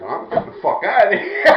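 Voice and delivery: high, loud, laughing retelling; mock panicked exit. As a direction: imitate someone desperate to leave. Acts out guy fleeing, laughing.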